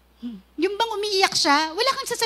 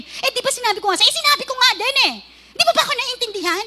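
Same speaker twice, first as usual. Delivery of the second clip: raised voice; 5 semitones higher, faster than usual. Acted. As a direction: snap back fast at a spouse who won't listen. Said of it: "pag mataas yung boses".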